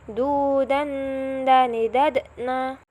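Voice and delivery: "Du, dan, dani, dadna" drawn out slowly, each syllable stretched; slow repeat. Shown as a demonstration. Teacher repeating the combined syllables slowly for students. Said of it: "Sekarang kita coba gabung".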